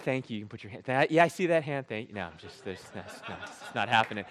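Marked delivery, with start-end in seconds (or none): none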